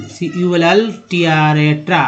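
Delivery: ordinary talk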